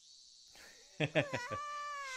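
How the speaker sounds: sudden high burst of laughter. Real, not acted. Laughing at Keiichi giving away his lunch, a sudden high burst of laughter.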